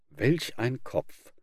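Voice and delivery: slow contemplative remark; musing. Acted. Fox studying the mask, musing slowly. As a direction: ponder it as you look. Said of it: "sagte der betrachtende Fuchs".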